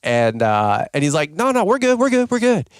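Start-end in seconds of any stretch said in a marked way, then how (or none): 1.3-2.7 high, quick brush-off, repeated; jittery stranger shooing off a helpful volunteer; squirrely dude. Retold or imitated. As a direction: wave him off like a jittery stranger. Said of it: "squirrely dude".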